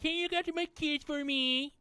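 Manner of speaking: high, put-on whiny voice mimicking her; mocking cousin. Mocking the cousin who dumps her kids, in a high whiny voice. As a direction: squeak it out like a pushy cousin.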